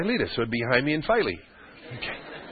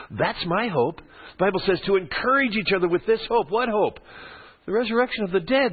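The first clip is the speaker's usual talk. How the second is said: higher, ringing pulpit voice building on the hope; fervent preaching; 6 semitones higher than usual. Acted. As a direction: preach it out to the church with conviction.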